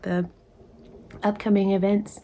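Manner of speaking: ordinary delivery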